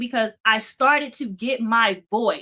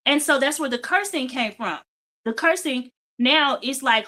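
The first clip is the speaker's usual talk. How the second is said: usual speaking voice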